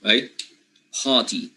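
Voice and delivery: British party with no r, pah-ty; British party with the r dropped; British accent. Shown as a demonstration. Teacher demonstrating the British pronunciation of party. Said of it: "British pronunciation should be something like this".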